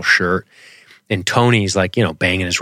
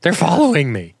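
higher-pitched mock paranoid voice; 8 semitones higher than usual. Quoting the delusional schizo, mock paranoid. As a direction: play it as a paranoid character.

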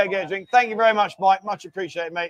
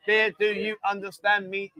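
slow, evenly spaced robot voice; mock robot talk; slower than usual. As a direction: speak slowly like a stiff machine. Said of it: "I feel like I'm talking like a robot".

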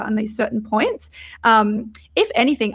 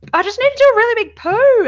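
urgent; 13 semitones higher, 7 dB louder, faster than usual. Retold or imitated. Feeling pressure, telling the midwives.

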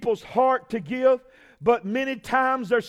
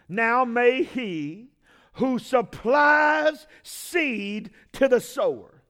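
slow emphatic reading; much slower than usual. Real, not acted.

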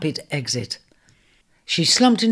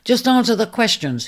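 a sharp, louder snap; snapping; 7 semitones higher, 5 dB louder than usual. Acted. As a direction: bite off the words impatiently. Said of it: "he snapped".

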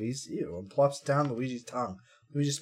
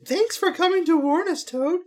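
high princess voice; 4 dB louder than usual. Acted.

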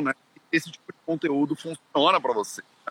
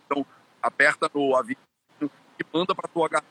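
ordinary talk

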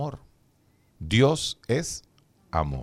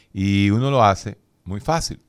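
ordinary talk

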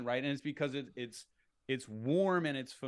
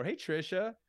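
familiar greeting; 4 semitones higher than usual. Shown as a demonstration. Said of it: "I've spoken to you a million times before".

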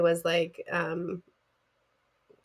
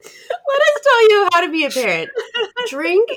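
loud, high, laughing through the words; laughing mockery; 13 semitones higher, 9 dB louder than usual. Co-host joking about their parenting advice, loud laughing mockery. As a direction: crack up while mocking your own podcast. Mimics listeners, then hosts, while laughing.

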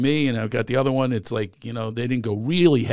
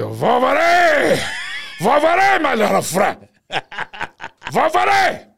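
put-on much higher, loud, slow Cuban-accented shout; Pacino Scarface impression; 13 semitones higher, 5 dB louder, slower than usual. Comedian showing how he played the ad line, Scarface shout. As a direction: bellow it like Tony Montana. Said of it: "Pacino in Scarface".

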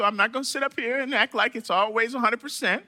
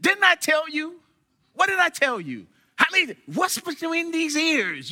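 raised-pitch mock telling-off; scolding; 7 semitones higher than usual. Telling off someone who is not doing right, mock scolding. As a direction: imitate an exasperated elder's reprimand. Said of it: "Usually you hear that when you're not doing right".

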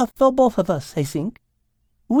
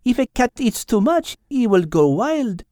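almost matter-of-fact; 10 semitones higher than usual. A narrator's reading. Mademoiselle explains catnip to Al, almost matter-of-fact. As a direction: explain it evenly in a French accent. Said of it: "in almost a matter-of-fact tone".

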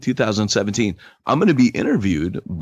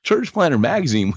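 excited plug; 8 semitones higher, 3 dB louder than usual. Real, not acted.

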